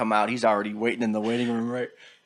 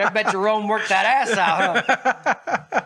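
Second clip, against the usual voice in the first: teasing laugh; 8 semitones higher, 3 dB louder, much slower than usual. Retold or imitated. Teasing brother after massage.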